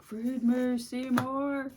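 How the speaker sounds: high, loud plant monster demand; Audrey II voice. Retold or imitated. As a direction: growl it like a hungry plant. Stomach growling like plant.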